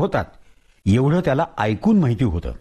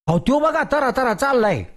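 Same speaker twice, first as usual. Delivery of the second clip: excited villager; 10 semitones higher than usual. Acted. Villager points at scorpion.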